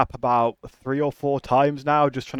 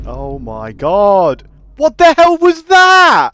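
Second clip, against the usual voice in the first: shocked shout; 12 semitones higher, 14 dB louder, much slower than usual. Real, not acted. Teleported down by game.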